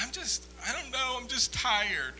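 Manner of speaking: high, mock-tearful exhaustion; on the verge of tears. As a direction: plead weakly as if about to cry from tiredness. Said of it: "on the verge of tears".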